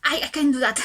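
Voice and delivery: ordinary talk, nothing marked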